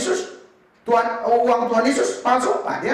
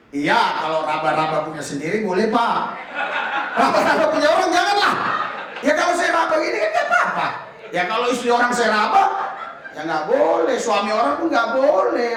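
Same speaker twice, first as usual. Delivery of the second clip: laughing retort. Retold or imitated. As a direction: rib the pastor while laughing. Joking retort to praying pastor.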